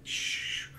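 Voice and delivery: ordinary talk, nothing marked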